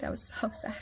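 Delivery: hushed whisper of a sad dream; sad, whispered confession. Real, not acted. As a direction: breathe it out, barely voiced.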